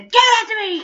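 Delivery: high, loud shrieked demand; shrill grabbing yell; mock scream. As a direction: scream it like a furious clerk grabbing the cart. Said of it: "screamed at me".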